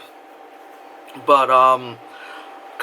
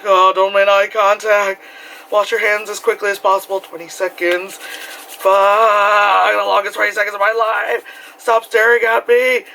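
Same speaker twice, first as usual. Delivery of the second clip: anxious inner voice; 5 semitones higher, slower than usual. Retold or imitated. Anxious inner monologue in bathroom.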